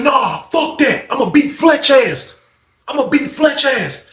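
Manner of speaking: high, stubborn retort; acted threat. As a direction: refuse in a high, worked-up voice. Acted threat in retold quarrel.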